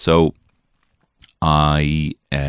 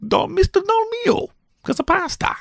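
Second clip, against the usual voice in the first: hammed-up voice; 4 dB louder than usual. Comic punning voice.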